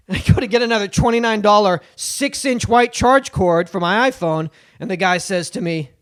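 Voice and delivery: normal talking voice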